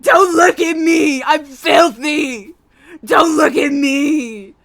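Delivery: loud, slow, crying shout; anguished shout. Retold or imitated. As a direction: wail it like you're horrified. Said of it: "I was literally like".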